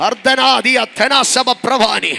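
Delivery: slow, drawn-out tongues; speaking in tongues. Real, not acted. Preacher praying in tongues before the church. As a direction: pray in tongues, unhurried.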